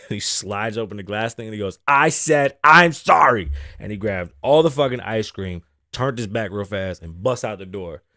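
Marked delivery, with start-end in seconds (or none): 1.9-3.5 loud, higher-pitched insistence; yelled apology. Retold or imitated. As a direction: imitate an unhinged man shouting sorry. Man yelling apology again.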